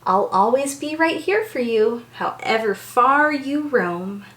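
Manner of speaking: gentle storybook reading; reading to children. Acted. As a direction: bedtime picture-book voice. Reads picture book aloud.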